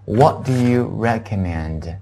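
slow, word-by-word English question; deliberate teacher's model with drawn-out words; model reading. Shown as a demonstration. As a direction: space the words out for learners. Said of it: "跟我读一下".

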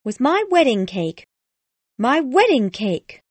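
high, loud cry over her ruined cake; shouting. A narrator's reading. Jilted bride shows the boy her wedding cake, shouting. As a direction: shout it out, pitched far above the narration. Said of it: "she shouted".

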